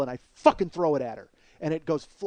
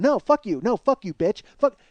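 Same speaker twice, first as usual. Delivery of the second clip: loud, high cursing; retold angry outburst; 5 semitones higher, 6 dB louder than usual. Man fighting Kathy for the phone, angrily cursing. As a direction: re-enact a furious retort. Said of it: "I'm getting fucking angry, angrier".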